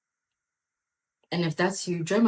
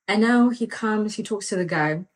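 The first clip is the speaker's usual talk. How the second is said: ordinary talk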